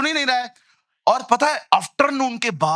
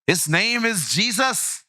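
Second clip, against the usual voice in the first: ordinary talk, nothing marked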